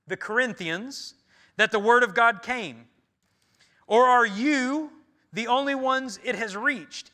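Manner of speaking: loud, proclaiming Paul's words; forceful scripture reading. Acted. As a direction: proclaim the verses like a herald.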